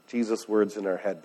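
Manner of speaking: usual speaking voice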